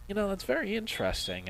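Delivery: high-pitched polite interest. Retold or imitated. Traveler retells his reply to an Italian shopkeeper, high-pitched interest. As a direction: lift the voice high as if politely intrigued.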